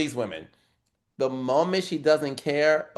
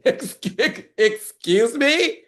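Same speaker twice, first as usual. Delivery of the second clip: high, slow, laughing disbelief; shocked; 8 semitones higher, 4 dB louder, slower than usual. Retold or imitated. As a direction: laugh out an outraged excuse me.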